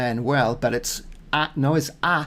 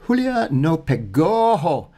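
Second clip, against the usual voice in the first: "Julia no pegó" slow, the words run together; slow linked practice reading; slow joined-up Spanish; 5 semitones higher, 3 dB louder, slower than usual. Shown as a demonstration. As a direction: link "Julia no pegó" slowly, no gaps between words. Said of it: "these had to be joined up".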